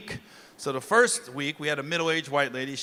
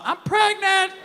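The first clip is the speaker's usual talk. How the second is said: high put-on woman's voice; 13 semitones higher, 4 dB louder, slower than usual. Nanny calling Gary, high put-on woman's voice. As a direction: play her on the phone, high.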